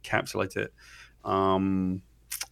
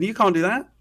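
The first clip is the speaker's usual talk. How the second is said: higher, louder outraged objection, imitated; mock freak-out; 9 semitones higher, 6 dB louder than usual. Imitating editors rejecting a risky idea, flipped out. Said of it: "everybody flipped out".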